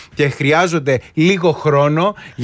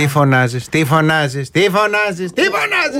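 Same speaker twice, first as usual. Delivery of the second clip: nagging mother. Retold or imitated. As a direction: climb up like a scolding mum. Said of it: "Αυτό γιατί το κάνανε οι μάνες".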